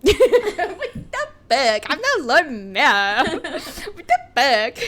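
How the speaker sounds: very high, loud, slow British parody, laughing; hammed-up British squawk breaking into laughter; mock British accent. Podcaster parodying the Lord Mayor swearing, laughing mock British accent.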